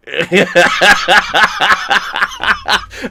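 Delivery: long loud, high cackle; real burst of rhythmic laughter; hearty laugh. Real, not acted. Cracking friends up over a truck story, hearty laughing. Laughing after torque punchline.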